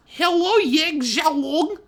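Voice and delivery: very high, loud, slow prissy voice; haughty heiress greeting. A narrator's reading. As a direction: greet her rival from on high. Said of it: "Weiss greeted".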